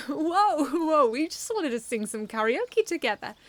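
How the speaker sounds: loud, high, mock-innocent protest; innocent drunk. A narrator's reading. Drunk man backing off from Snow, high innocent protest. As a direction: play the harmless drunk. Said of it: "one of them exclaimed in an effort to appear innocent".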